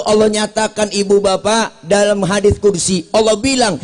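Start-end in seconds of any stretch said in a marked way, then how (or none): none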